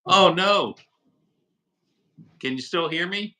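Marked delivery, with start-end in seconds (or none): none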